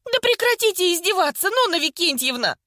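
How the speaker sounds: high-pitched exasperated outburst; indignant protest. A narrator's reading. Vika to Nonna who keeps mocking her, indignant protest. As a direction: demand that the teasing stop.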